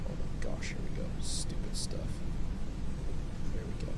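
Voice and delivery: whispered grumbling; exasperated whisper. Real, not acted. Grumbling to herself over stupid texts, annoyed whisper. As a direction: hush it out, annoyed.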